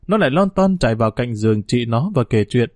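plain reading voice

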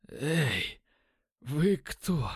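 feeble, hoarse voice, in broken bits; weak rasp. A narrator's reading. Dazed addict challenging the intruders, weakly rasping. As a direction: rasp it out as if starved for days. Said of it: "прохрипел он слабым голосом".

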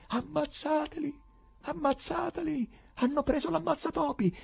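high, urgent soldier's command yell; battle cry. Acted. Pilate commanding his soldiers in battle, high urgent yell. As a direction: scream orders across the valley. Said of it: "Pilato aveva gridato ai suoi soldati".

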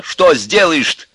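high, loud, slow challenge; sudden anger. A narrator's reading. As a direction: challenge your father angrily. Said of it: "рассердился вдруг Костя на отца". (сердито, громко, с вызовом)

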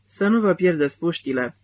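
plain reading voice

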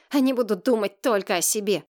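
plain reading voice

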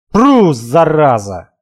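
slow, drawn-out call to stop a horse. Acted. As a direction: drag out the call to rein in a horse. Stopping the horse, shouting. (протяжный окрик на лошадь)

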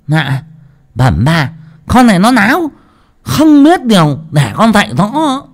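high, loud lisping servant voice; lisping cook. A narrator's reading. Cook justifying herself to mistress, lisping shrill complaint. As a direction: start deferential, then rise into loud indignation. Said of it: "Mụ bếp ngọng theo thói quen".

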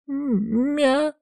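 very high, drawn-out cat meow; plaintive meow. A narrator's reading. Cat wrapped in a towel protesting, plaintive meow. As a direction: mewl in pitiful protest. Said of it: "вдруг жалобно возмутился кот".

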